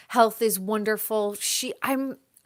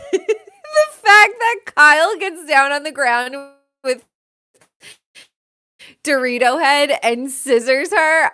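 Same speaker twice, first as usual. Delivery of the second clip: high, loud laughing through the words; laughing hard; 6 semitones higher, 8 dB louder than usual. Real, not acted. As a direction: crack up while telling it. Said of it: "laugh cry".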